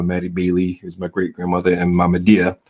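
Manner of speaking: usual speaking voice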